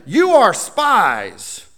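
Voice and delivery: a governor speaking harshly to the brothers, slow and rough; rough accusation. Acted. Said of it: "he spoke roughly to them".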